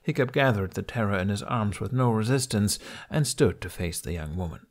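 usual speaking voice

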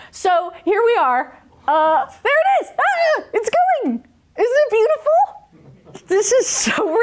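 high, slow, wonder-struck gushing; mock awe. Showing the audience the robot swimming, high delighted awe. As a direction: gush over the robot like a proud parent. Watching robot video, marvelling aloud.

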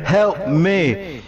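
mock plea fading into the background; fading cry for help. Gamer mimics abandoned teammate calling out, mock fading plea. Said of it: "just slowly, slowly going in the background, fading and shit".

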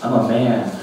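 ordinary talk

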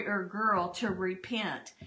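ordinary delivery

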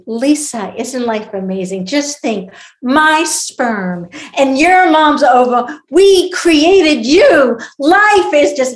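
imitating a loud, rapturous drunken rant; drunk dad ranting. Drunk dad to twelve-year-old daughter on a plane, imitated ranting. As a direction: build to booming, drawn-out mock grandeur. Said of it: "he started ranting on the plane".

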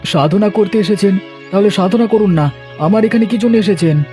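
high, irritated questioning; hostile challenge. Acted. Householder challenging the visiting holy man, irritated and high-pitched. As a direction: confront an unwelcome visitor. Dibakar resents the tantric's visit.

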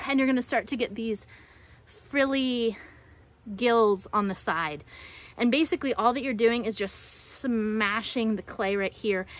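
ordinary delivery

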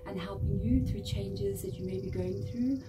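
soft, unhurried meditation voice; guided meditation. Acted. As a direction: guide the listener gently into relaxation, pausing between phrases.

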